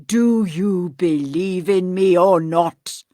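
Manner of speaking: hollow ghost. Acted. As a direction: intone like a dead man's spirit. Voices ghost.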